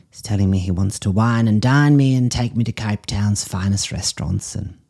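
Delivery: ordinary talk, nothing marked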